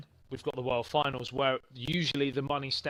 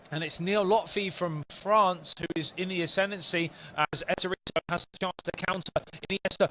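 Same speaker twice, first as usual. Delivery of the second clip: higher, louder live football call; play-by-play commentary; 5 semitones higher, 5 dB louder than usual. Acted. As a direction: call the match live with rising excitement.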